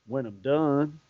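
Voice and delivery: low, soft imitation of a Texas accent. Host recalling the ballplayer's unforgettable look, quiet Texas accent. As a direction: murmur it in a mock Texas accent. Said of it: "in that big Texas accent that he has".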